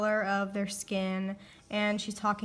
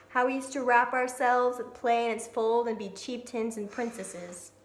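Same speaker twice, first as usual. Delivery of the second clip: measured poetry recitation, each line opening firmly and settling at its end; poem reading; 2 semitones higher, 5 dB louder than usual. Real, not acted. Reader presents The Century Quilt before discussing it, poem reading. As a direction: read it out as a poem, phrase by phrase.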